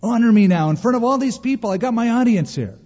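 mock pleading Saul. Saul pleading with Samuel for public honor, high and fast. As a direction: beg for the spotlight. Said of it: "he goes".

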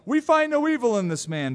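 high, loud protest. Acted. Scribes protesting to the council, high and loud. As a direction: shout him innocent. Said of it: "arose and protested".

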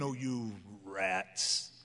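low, drawn-out mock contempt; mock pulpit scorn. Preacher mimicking guilt-heaping pulpits, slow low mock scorn. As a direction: drop low and stretch the insult. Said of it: "I hear from pulpits preached all the time".

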